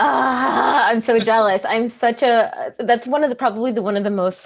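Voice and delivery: breathless jealous delight; excited gushing. Real, not acted. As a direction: gush like a superfan who missed the show. Fan gushing about live show.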